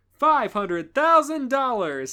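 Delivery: slow loud game show reveal; dramatic host announcement. Acted. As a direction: announce the prize big.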